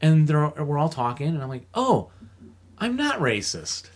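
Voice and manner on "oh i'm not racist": high, slow, nervous reassurance; mock nervous. In Burger King reassuring himself, high and slow. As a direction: protest a bit too much.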